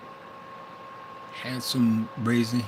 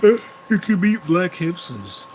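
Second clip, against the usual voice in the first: mock astonishment; 6 semitones higher, 7 dB louder, much slower than usual. Mock shocked reveal.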